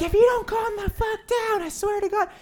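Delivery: high mock yell; angry retort. Son yelling back at mom, high and furious. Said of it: "and I'm like".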